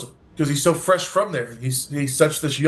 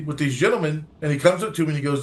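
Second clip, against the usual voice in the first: normal talking voice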